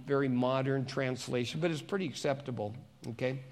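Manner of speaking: ordinary talk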